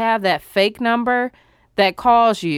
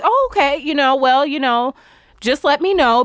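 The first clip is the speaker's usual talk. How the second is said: much higher, sugary polite voice; fake-nice, pitched way up; mock polite self; 4 semitones higher than usual. Telling the driver to just say, in a much higher fake-polite voice. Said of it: "And I was like".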